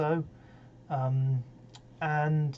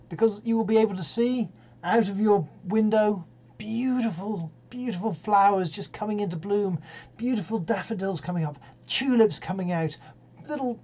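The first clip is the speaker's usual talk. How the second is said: higher, lilting enthusiasm; real delight; 5 semitones higher than usual. Real, not acted. Host telling listeners about flowers coming into bloom, delighted. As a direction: gush with wonder, pitch lifting to the end.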